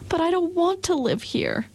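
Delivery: put-on high young girl's protest; Dorothy crying out. A narrator's reading. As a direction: cry out like a small girl who won't stay. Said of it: "cried Dorothy".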